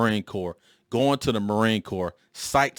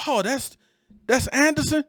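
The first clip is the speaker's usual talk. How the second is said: high, put-on surprised question; mock surprise. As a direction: pretend to be amazed. Old boss feigning recognition.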